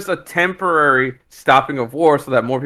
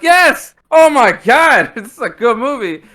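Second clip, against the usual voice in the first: high, loud, thrilled yes and a slow oh my god; reliving the moment the movie won him over; cheering at the screen, laughing into it; excited outburst; 10 semitones higher, 5 dB louder, slower than usual. Retold or imitated. Retelling his delight watching.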